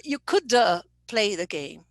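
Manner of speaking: an extra "uh" after "could" before "play"; learner's intrusive schwa. Shown as a demonstration. Teacher showing a student's error, intrusive schwa. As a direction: release "could" into a little "duh". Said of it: "when a student says".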